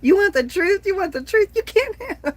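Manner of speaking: high, loud courtroom shout, broken off by laughter; Jack Nicholson impression again. Doing the movie line for viewers, loud and high until she laughs. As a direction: yell the famous line until the giggles win. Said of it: "In my best Ni-, Nicholson voice".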